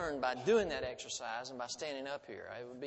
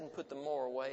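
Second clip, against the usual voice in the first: usual speaking voice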